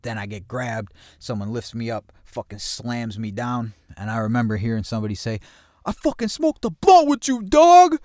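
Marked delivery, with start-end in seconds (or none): 5.8-8.0 very high, loud mock yell; imitated outraged shout. Teller mimics someone during the beating, outraged high shout. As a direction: holler it as the betrayed attacker.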